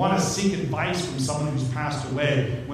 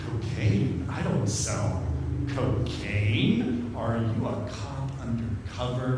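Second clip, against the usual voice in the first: wary drug dealer; 6 semitones lower, 4 dB quieter than usual. Acted. Suspicious dealer in imagined drug bust.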